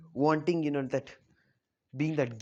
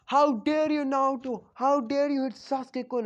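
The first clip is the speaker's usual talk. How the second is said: much higher, louder fangirl shriek; outraged fangirls; 9 semitones higher, 4 dB louder than usual. Retold or imitated. Fangirls shrieking at Naruto for hitting Sasuke, high and loud. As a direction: squeal it like an offended schoolgirl. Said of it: "every girl are like".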